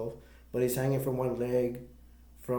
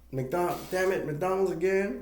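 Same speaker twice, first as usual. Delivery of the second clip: higher-pitched imitation of annoyed complaint; mock exasperation; 6 semitones higher than usual. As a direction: complain in disbelief, lightly acted out. Bud complains about McDonald's, retold.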